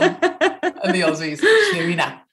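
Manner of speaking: laughing, slow Aussie "tuna". Shown as a demonstration. Host showing how Aussies say tuna, laughing. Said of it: "a segue there about the Aussie accent".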